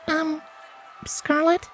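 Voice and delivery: small, high, hesitant filly voice; timid. A narrator's reading. Primrose asking Scarlet, timidly. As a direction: ask shyly, barely daring. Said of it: "she asked timidly".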